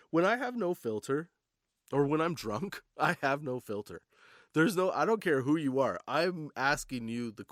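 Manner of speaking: ordinary delivery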